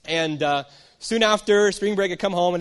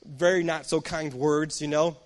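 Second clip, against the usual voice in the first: ordinary talk, nothing marked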